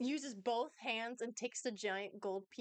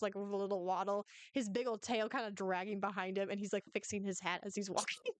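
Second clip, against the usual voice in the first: ordinary delivery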